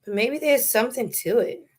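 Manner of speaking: ordinary talk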